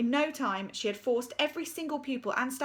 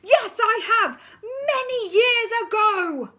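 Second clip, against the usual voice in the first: high, loud shout with a crazed laugh; deputy head yelling gleefully; manic shout; 13 semitones higher, 12 dB louder, slower than usual. Acted. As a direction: cackle it out like a madwoman. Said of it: "shouted Miss Seeth".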